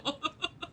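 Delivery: a quick high giggle; short high giggle at the joke; high breathy laugh. Real, not acted. Laughs at own bit.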